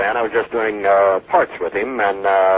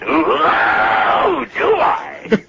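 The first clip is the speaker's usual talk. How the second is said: stretched ooh; 6 semitones higher, much slower than usual. Acted. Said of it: "see what I could do with ooh".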